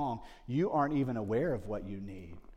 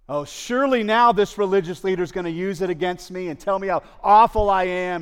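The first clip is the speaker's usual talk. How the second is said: louder and higher, bracing for judgement; a raised, higher cry of fearing the worst; anxious dread; 5 semitones higher, 12 dB louder than usual. Acted. Samaritan woman expecting condemnation, loud and anxious.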